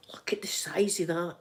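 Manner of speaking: soft, whispery awe; hushed aside. Real, not acted. Vlogger marvels at the size of it, hushed. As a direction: drop to a near whisper.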